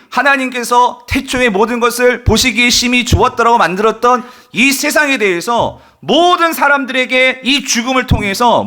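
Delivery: high, loud, driving sermon delivery; full-force preaching. Real, not acted. Preacher on Jesus' wrath at death's power, full-force preaching. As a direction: build to an impassioned, sustained cry from the pulpit.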